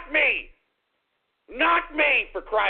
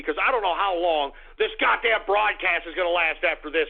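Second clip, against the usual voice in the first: ordinary talk, nothing marked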